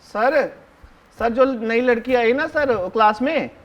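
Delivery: normal talking voice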